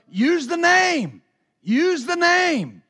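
high, slow, drawn-out urging; slow inner voice. Retold or imitated. The spirit rising in Shambach, high and slow. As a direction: stretch each word like a voice from within.

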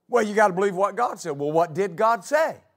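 normal talking voice

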